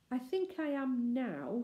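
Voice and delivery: slow and thoughtful, drawn out; slowly. A narrator's reading. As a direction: weigh each word, unsure. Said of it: "said Anthea slowly".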